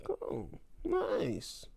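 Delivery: very high soft sarcastic approval; high slow sarcastic "Nice"; falsetto mock nice. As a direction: squeak out fake politeness. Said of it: "I'm like".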